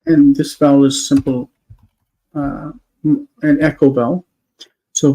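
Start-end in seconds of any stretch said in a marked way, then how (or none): none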